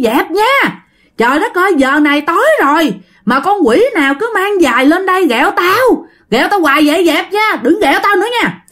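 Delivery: high-pitched angry telling-off; chửi bực tức. Acted. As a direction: scold the pranksters below in a high, exasperated voice. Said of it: "dì Năm mới chửi". (chửi mắng, giọng cao bực bội)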